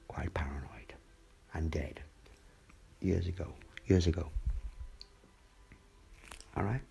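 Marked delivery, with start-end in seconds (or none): 0.1-2.0 whisper. Real, not acted. Conspiratorial aside.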